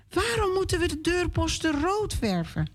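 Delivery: put-on high, curious little girl voice; a lightly voiced child asking; child's question. Hanna asks papa why, in a high child's voice. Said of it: "vraagt Hanna aan haar papa".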